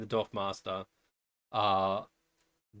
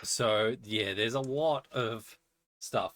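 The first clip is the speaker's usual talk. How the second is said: usual speaking voice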